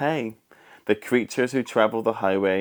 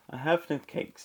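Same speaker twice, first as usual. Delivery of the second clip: timid rabbit; 7 semitones higher, 6 dB quieter than usual. A narrator's reading. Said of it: "the rabbit replied".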